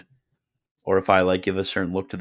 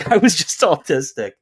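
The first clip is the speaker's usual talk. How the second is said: laughing through the words, pitched up; 9 semitones higher, 8 dB louder than usual. Real, not acted. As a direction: burst into laughter mid-sentence. Laughs retelling his trolling mishap.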